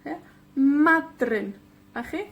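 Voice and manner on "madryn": Madryn with MA stretched and stressed; MA-dryn, first syllable strong. Shown as a demonstration. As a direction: hit MA in Madryn, drop on dryn. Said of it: "la penúltima con la énfasis".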